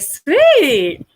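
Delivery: high, loud gleeful exclamation; retold delight. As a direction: relish the word like great news. Acting out her delight at waterproof.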